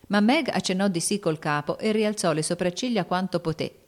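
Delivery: ordinary delivery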